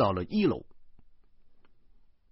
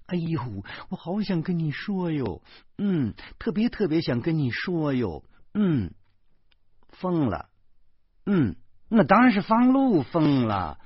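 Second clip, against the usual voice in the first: pitched-up wheedling confiding; gushing phone talk; 7 semitones higher than usual. A narrator's reading. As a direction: gush to a friend on the phone. Wife gushing on phone to friend.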